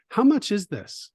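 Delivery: put-on a small kid politely asking the price; little boy asking. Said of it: "said to her".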